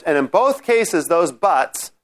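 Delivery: ordinary delivery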